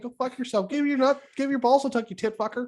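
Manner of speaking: put-on high Canadian trash-talk accent; Shorzy impression. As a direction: chirp like Shorzy. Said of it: "slipping into Shorzy".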